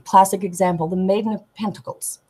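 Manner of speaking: normal talking voice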